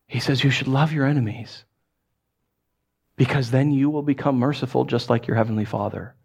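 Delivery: normal talking voice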